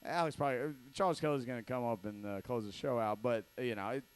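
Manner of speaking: normal talking voice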